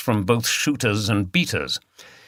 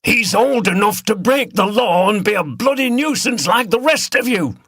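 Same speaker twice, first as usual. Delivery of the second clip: loud, raised man's retort; called back; 12 semitones higher, 4 dB louder than usual. A narrator's reading. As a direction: shout back at her. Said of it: "called back one of the beaters".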